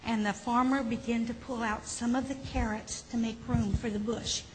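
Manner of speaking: usual speaking voice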